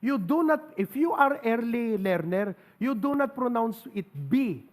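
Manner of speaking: ordinary talk, nothing marked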